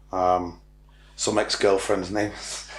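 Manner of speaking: ordinary delivery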